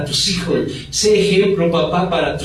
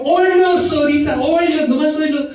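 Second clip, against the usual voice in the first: high sneering neighbour; mocking neighbour; mocking gossip; 10 semitones higher than usual. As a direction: taunt them in a high voice.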